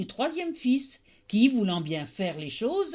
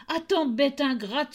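angry tailor; 6 semitones higher than usual. Acted. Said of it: "s'écria-t-il".

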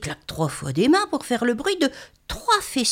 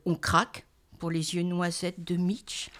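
usual speaking voice

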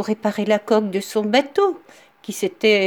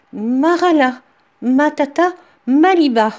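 Marala, matata, maliba called out loud and higher; cried out; 5 semitones higher, 6 dB louder than usual. Acted. Woodcutter cries the stop spell at the mill, loud call. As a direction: shout the stop words to halt the mill. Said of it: "le bûcheron s'écria".

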